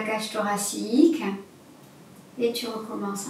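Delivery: guided breathing. Acted.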